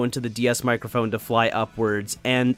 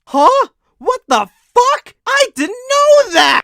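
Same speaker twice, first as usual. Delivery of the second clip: shocked disbelief; 10 dB louder than usual. Pretend. Reacting to a surprising fact.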